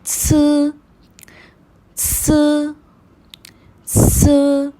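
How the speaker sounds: स as a plain hiss; pinyin s sound. Shown as a demonstration. Teacher modelling pinyin s as स. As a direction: hiss स through the teeth. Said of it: "एस को pronounce करते हैं".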